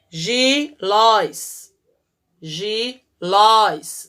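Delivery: "Gilóis" stretched very slowly and loud, gi-lóis; syllable by syllable. Shown as a demonstration. Teacher sounds out the word syllable by syllable. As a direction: pull "gilóis" apart syllable by syllable, landing on the "ó". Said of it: "acento agudo no ó".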